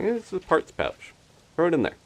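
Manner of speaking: high, careless shrug-off voice; dismissive. Retold or imitated. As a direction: toss it off like it's nothing. Viewer's careless attitude quoted.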